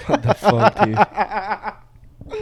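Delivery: high-pitched, laughing protest; helpless laughter through the words; laughing disbelief. Real, not acted. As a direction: crack up while protesting in a high voice. Cracking up at crude joke.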